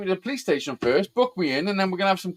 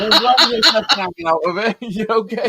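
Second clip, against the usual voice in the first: higher, laughing delivery; 4 semitones higher, 5 dB louder than usual. Real, not acted. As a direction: laugh through the punchline. Joking about strip search.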